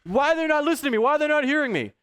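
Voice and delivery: high, fast mock whine; triggered whining. Preacher mocking someone upset and triggered: high fast whine. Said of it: "upset and triggered".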